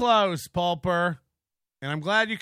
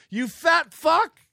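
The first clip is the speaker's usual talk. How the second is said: higher, louder and slow, playful roar; mock insult yell; 8 semitones higher, 5 dB louder, slower than usual. Real, not acted. Streamer playfully yelling an insult at Kevin. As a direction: bellow it half-joking.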